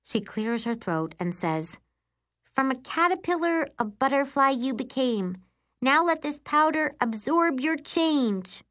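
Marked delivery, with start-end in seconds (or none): 2.4-8.6 high, measured spell-casting voice; spell incantation. Acted. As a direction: recite it like a magic rhyme, slowing at the end. Sister recites a magic spell.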